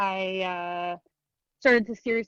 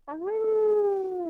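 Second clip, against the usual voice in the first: high howling dog voice; 11 semitones higher than usual. Retold or imitated. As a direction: howl like a pit bull. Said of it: "Pugsley says".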